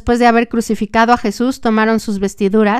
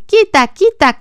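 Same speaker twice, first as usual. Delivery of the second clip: much higher shouted cry; crowd shouting; 9 semitones higher, 4 dB louder, slower than usual. A narrator's reading. Preacher voicing crowd rejecting Jesus, loud shout. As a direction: throw it out high like a baying crowd. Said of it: "Y ellos gritaban".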